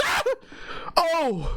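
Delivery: high startled yelp; high imitated shriek of fright; mock startled scream. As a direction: squeal like a big guy spotting a rat. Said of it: "he goes".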